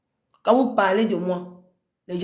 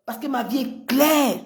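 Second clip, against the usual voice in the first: normal talking voice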